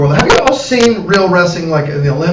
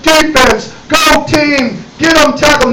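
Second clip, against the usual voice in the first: mock regular cheer; 8 semitones higher, slower than usual. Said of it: "She wasn't".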